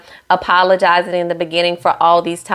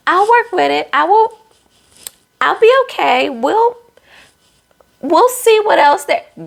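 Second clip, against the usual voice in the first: higher, hammed-up imitation of a nervous bride; mock Alexis voice; 10 semitones higher than usual. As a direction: play her trying to sound sure she'll be fine. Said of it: "She's like".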